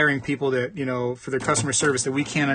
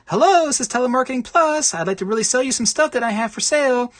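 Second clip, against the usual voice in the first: high, bright sales pitch, louder; eager telemarketer; 9 semitones higher, 4 dB louder than usual. Retold or imitated. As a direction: try the pitch once more, still peppy. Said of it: "let me try it again".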